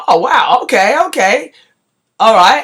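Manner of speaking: a friend watching someone down a pint, amazed; impressed surprise. Retold or imitated. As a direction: react as if you can't believe it.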